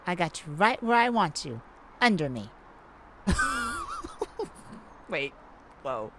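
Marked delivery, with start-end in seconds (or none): 3.2-5.0 high gloating laugh; villainous cackle. Acted. Thief pinning down the cop, cackling. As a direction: cackle like you've won. Gloating over pinned man.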